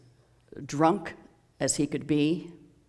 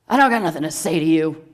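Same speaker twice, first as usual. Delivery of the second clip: put-on louder, fast, surly drunk's retort; 8 dB louder, faster than usual. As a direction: snap back like a belligerent drunk. Drunk snarls at old man.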